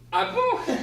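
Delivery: high, loud startled "Ah bon?" with a laugh; surprised laugh. Acted. Wife reacting to husband's costume, high startled laugh. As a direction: squeak it out then laugh in disbelief.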